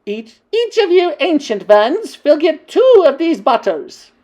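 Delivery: high, slow, shrill-free witch shout pitched well up; Grand High Witch shouting. Acted. Witch queen shouting to her ancient witches. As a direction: boom out the gift like a witch queen. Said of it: "she shouted".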